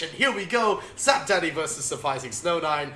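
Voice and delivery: lively play-by-play caster voice; esports caster. Acted. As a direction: call the match like a caster. Casts their own match.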